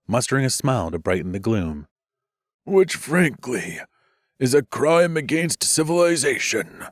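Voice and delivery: weak wry joking. Acted.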